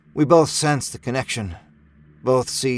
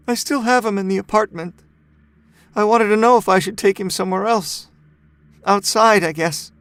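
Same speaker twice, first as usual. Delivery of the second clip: higher, pleading woman's voice on the edge of tears; a touch of held-back crying, voice raised; tearful mother; 8 semitones higher, 3 dB louder than usual. A narrator's reading. Grieving mother asks neighbor what to do with her son, tearful. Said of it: "She immediately begins to cry".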